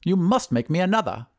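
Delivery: high wizard voice; high-pitched, insistent wizard; demanding wizard. Acted. Wizard demands a new dog.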